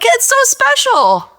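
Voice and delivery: high, loud, slowed gush of feeling; emotional gush. Retold or imitated. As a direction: squeal it out, moved almost to tears. Quote, getting emotional over sundae.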